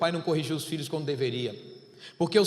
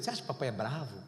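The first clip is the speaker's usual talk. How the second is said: father asking son; 7 semitones lower, 8 dB quieter, much faster than usual. Retold or imitated. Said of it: "aí eu perguntei pra ele".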